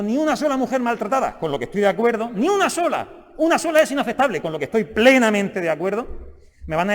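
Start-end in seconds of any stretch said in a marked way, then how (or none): 0.0-1.4 raised, higher-pitched slogan quote; emphatic mock-campaign quote; quoted slogan. 2.3-4.4 SOLA pitched high and stretched; high, forceful stress on "sola"; emphatic insistence. Real, not acted. Insistent repeat of quoted slogan.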